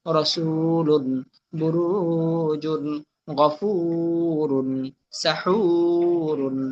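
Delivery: very slow, stretched long vowel in each word; elongated vowels. Shown as a demonstration. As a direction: hold the madd, one word at a time. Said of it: "শুধু টান আসলে টান দিব". (দীর্ঘ টান দিয়ে ধীরে উচ্চারণ)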